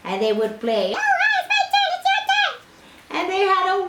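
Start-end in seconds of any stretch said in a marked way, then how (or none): 1.0-2.5 very high, chirpy game voice; high playful voice. Acted. Children taking turns at chess, very high and playful. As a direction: squeak it out like a child playing. Princess and Isumboshi play chess.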